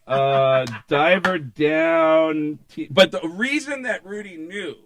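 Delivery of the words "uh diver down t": mock recall. Mock continuation of the uncanny recall.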